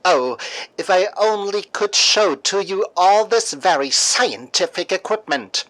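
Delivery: ordinary delivery, nothing marked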